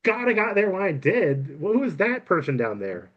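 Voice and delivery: put-on high, urgent girl character; relieved rescuer. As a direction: exclaim it high, then press for answers. Relieved girl asks about attacker.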